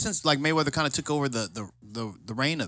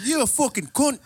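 higher-pitched pretend Irish insult; Irish accent; 10 semitones higher than usual. Imagined Irish friend insulting him, playful Irish accent. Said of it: "had an accent like that".

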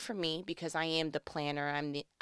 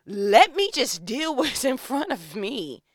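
raised, louder voice of someone rattled; exasperated outburst; 5 semitones higher, 8 dB louder than usual. Acted. The rattled person wanting to deal with one thing, exasperated. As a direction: burst out, fed up with small interruptions.

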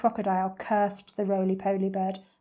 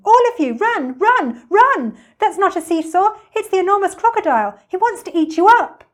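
very high, loud alarm; frantic high-pitched monkey shouting; shouted warning; 11 semitones higher, 12 dB louder than usual. A narrator's reading. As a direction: yell an urgent warning to children. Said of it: "Mugglewump shouted to the children".

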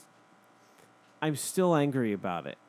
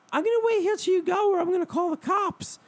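much higher, louder squeak; squeaky lady; 5 dB louder than usual. Retold or imitated. The lady threatening the driver, squeaky. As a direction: threaten in a shrill put-on voice. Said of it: "her voice was very like this".

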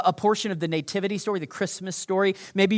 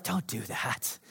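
soft warning; 3 semitones lower, 7 dB quieter, slower than usual. Acted. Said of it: "And God's like".

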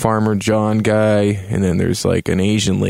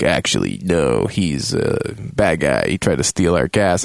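accusing council man; 5 semitones lower than usual. Retold or imitated. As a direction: drop low and drag out the accusation. Acts the guy accusing him.

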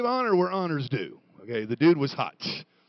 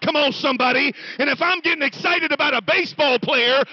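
high, loud preaching building to a shout; full-force preaching; 8 semitones higher, 10 dB louder than usual. Real, not acted. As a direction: drive it home to the congregation, louder and louder.

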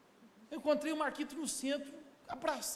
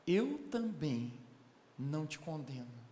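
solemn Jesus; 9 semitones lower, slower than usual. Acted. Jesus speaking to the accused woman.

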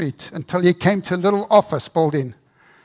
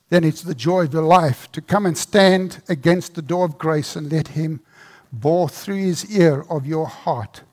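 ordinary delivery

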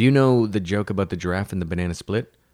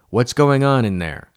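raised, higher voice calling from afar; 4 semitones higher, 6 dB louder than usual. A narrator's reading. Mother approaching the wrecked living room, calling out. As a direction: call in to someone in another room.

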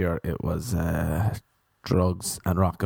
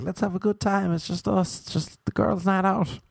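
put-on higher, chirpy girly chatter; girls' night out voice; 8 semitones higher than usual. Women at a bar telling each other, lightly girly. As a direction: play a giddy woman out with friends. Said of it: "just like".

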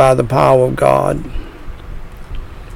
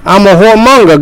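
doubting sinner voice; 6 semitones higher, 3 dB louder, faster than usual. Pretend.

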